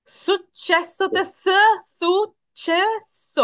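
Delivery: সুচ্ছেস stretched out, syllable by syllable; very slow demo. Shown as a demonstration. Teacher slowly spells out the word's sound for students. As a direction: drag out সুচ্ছেস slowly for the class. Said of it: "এটা স".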